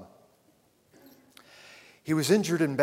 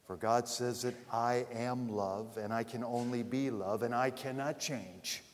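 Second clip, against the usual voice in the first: ordinary delivery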